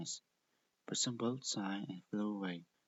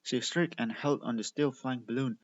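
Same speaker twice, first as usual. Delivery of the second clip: ordinary delivery, nothing marked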